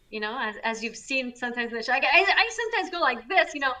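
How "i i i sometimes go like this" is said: higher and louder, showing off his loud side; loud burst. Shown as a demonstration. Host showing guests how loud he gets on the show, loud. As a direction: let the energy blow out loud for a moment. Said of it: "I can be sometimes very loud".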